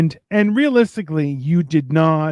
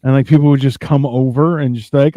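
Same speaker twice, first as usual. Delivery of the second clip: ordinary talk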